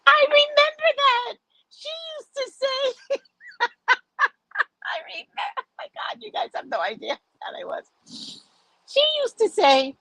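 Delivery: high pitched laughing talk. Real, not acted. Laughing while recalling.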